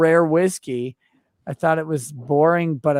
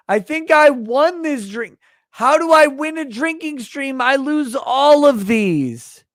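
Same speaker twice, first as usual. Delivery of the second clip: excited; 9 semitones higher than usual. Real, not acted. Drunk streamer marvels, voice high.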